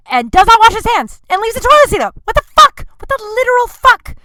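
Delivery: high, loud, outraged squawk at the landlord; outraged disbelief. Retold or imitated. Ranting to listeners about the landlord, outraged high shout.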